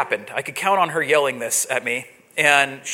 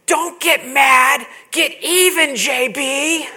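mom's sideline yell; 8 semitones higher, much slower than usual. Retold or imitated. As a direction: scream it at your kid from the sideline. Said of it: "She would yell".

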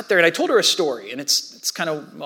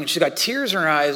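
ordinary delivery

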